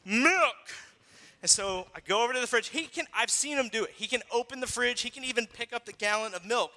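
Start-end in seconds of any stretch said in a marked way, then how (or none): 0.0-0.7 put-on toddler demanding. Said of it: "and he goes".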